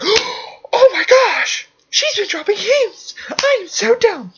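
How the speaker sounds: high, slow gasp of realization; gasping mock realization. Mocking Ted realizing the hints, high slow gasping squeal. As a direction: gasp then squeal it out like a ditzy girl. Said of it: "Ted's like".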